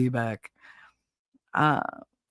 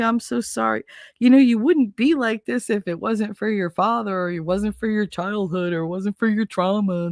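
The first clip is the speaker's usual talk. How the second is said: higher, syrupy mock sweetness of a manipulative ex; mock sweet ex; 6 semitones higher than usual. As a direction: coo it like a guilt-tripping ex buttering you up. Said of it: "my ex would get really sweet".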